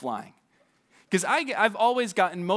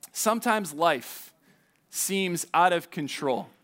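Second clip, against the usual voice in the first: ordinary talk, nothing marked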